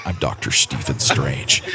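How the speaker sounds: a deep, slow movie-hero nasal voice; nasal Doctor Strange. Shown as a demonstration. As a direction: drop low and slow it down. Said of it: "you do that and you go".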